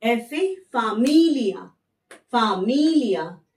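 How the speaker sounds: फामिलिया slowly, each syllable clear; slow model word. Shown as a demonstration. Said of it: "ये देखिए".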